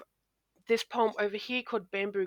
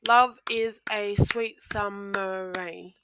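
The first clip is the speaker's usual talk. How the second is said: slow count-along, every syllable separated; slower than usual. Shown as a demonstration. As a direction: clap out "love is a sweet summer rain" beat by beat. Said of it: "the next line is seven syllables".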